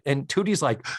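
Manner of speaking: ordinary delivery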